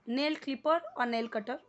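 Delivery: ordinary talk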